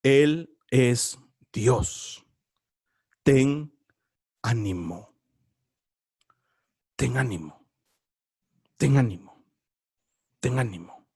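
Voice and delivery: slow, very low solemn divine voice; deep intoned voice of God. Acted. Preacher voicing God, very low and solemn. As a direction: intone it like God speaking from above.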